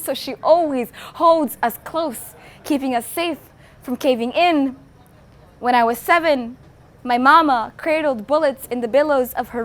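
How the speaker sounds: spoken word poetry. Acted. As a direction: recite it proudly, building to a defiant challenge. Performs poem about her mother.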